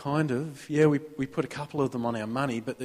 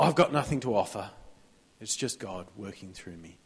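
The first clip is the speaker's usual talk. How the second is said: hushed, much softer falsely modest reply; mock humble; 7 semitones lower, 7 dB quieter than usual. Churchgoer brushing off a compliment, mock humble and hushed. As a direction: shrink away from the praise, voice dropping.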